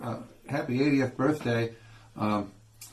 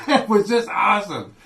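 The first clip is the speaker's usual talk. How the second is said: laughing out loud, higher and louder; 9 semitones higher, 8 dB louder than usual. Real, not acted. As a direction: burst out laughing at the memory. Recalling prank, bursts out laughing.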